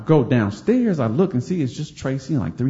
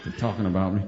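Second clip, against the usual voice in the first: much lower and much softer, muttered aside; embarrassed under breath; 8 semitones lower, 5 dB quieter than usual. Retold or imitated. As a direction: sink down and murmur it to yourself.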